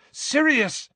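high-pitched call of alarm; Harry, loud. A narrator's reading. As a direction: call out sharply to stop him. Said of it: "said Harry loudly".

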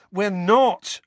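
high, loud protest; interrupting. A narrator's reading. Ron breaking into Harry's outburst, interrupting protest. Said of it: "Ron interrupted".

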